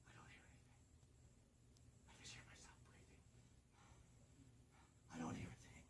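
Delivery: hushed, breathless whisper under the blanket; spooky storyteller whisper. A narrator's reading. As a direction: whisper so the monster can't hear. Hiding in bed, listening.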